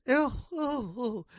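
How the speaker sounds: high, loud horrified oohs; hammed-up shock from a snobby guest; scandalised wife. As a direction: gasp out mock horror, very high. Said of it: "the boss's wife is quite".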